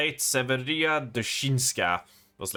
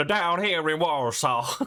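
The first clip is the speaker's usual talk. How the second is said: cowboy Texas twang for a foreign noble; hammed-up Texas accent; 3 semitones higher, slower than usual. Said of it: "with a Texas accent".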